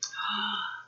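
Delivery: breathy startled gasp; storyteller gasping for the class; soft mock gasp. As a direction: gasp softly as if surprised. Reaction to the thing appearing.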